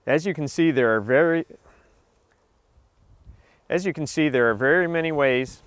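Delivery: cold lips stumbling on words; shivering lips stuttering through the line. Real, not acted. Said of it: "My lips are freezing here".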